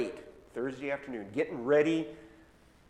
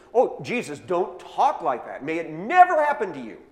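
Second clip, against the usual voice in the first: loud, higher, pleading outburst; alarmed protest; 6 semitones higher, 13 dB louder than usual. Acted. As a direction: cry out to stop him saying it. Peter protests Jesus' death prediction.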